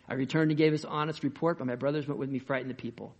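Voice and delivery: ordinary talk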